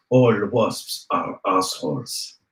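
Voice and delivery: low, slow and deadpan; deep slow announcer voice. Pretend. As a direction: drawl it out like a movie trailer. Recording a bot response line.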